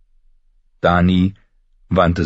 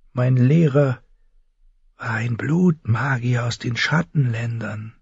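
ominous character voice at raised pitch; mysterious healer woman; 9 semitones higher than usual. A narrator's reading. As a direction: confide a dark secret guardedly.

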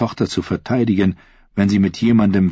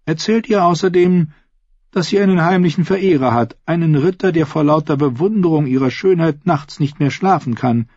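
high, brisk-spirited royal instruction; scheming queen; 10 semitones higher, 4 dB louder than usual. A narrator's reading. Queen briefing her lady-in-waiting, scheming. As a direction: dictate the message in a lifted voice.

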